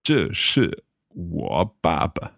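这是我爸爸 said slowly, word by word; slow careful repeat. Shown as a demonstration. Learner repeating after teacher.

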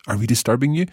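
"Are we disturbing you?" lifting high on "you"; question intonation. Shown as a demonstration. Teacher showing Katie intonation alone, question intonation. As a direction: ask "Are we disturbing you?" with a rising end. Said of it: "just by changing the intonation of your voice".

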